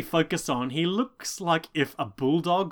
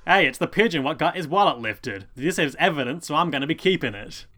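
louder, hammed-up Cockney copper; Cockney accent; 3 dB louder than usual. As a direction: play a crooked cop in broad Cockney. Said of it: "I'm just doing the Cockney again".

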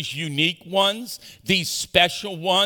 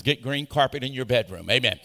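ordinary talk, nothing marked

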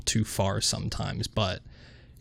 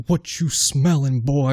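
mock Rock impression; 2 semitones higher, slower than usual. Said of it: "I tried to do The Rock".

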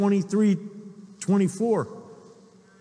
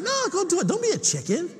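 high-pitched imitation of a pestering wife; mimicked wife's higher-pitched nagging; goading wife; 10 semitones higher than usual. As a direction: egg him on in a high voice, not yet yelling. Wife goading him to dive.

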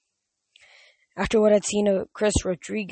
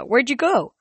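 calling out; 7 semitones higher, 7 dB louder than usual. A narrator's reading. Grover calling from the arena.

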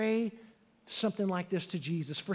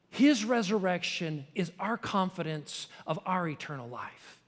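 ordinary talk

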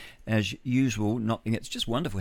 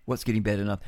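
ordinary talk, nothing marked

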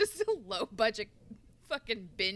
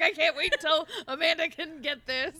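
laughing; 5 semitones higher, 7 dB louder than usual. Retold or imitated. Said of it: "I'm like".

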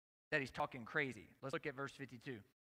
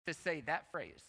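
ordinary delivery

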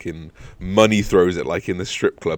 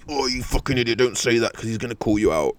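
higher, exasperated mock scolding; exasperated inner rant; 5 semitones higher than usual. Cursing the friend who blurted out the crash, exasperated. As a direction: scold him in your head, wound up.

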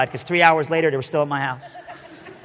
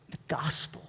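hushed; 15 dB quieter than usual. Real, not acted. Hushed reverent climax.

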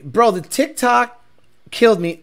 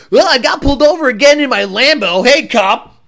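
louder, quicker mock show-off; hammed-up guy bragging to camera; cocky speeder impression; 4 semitones higher, 4 dB louder than usual. Mocking a Lambo driver greeting a cop, cocky.

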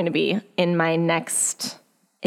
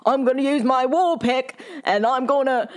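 raised, hammed-up adventurer voice; in-character voice; 6 semitones higher than usual. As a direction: narrate as your character. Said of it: "she's in character while she's describing".

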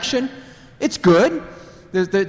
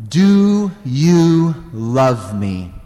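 tender question; 2 semitones lower, 4 dB louder, much slower than usual. Acted. Jesus asking Peter tenderly.

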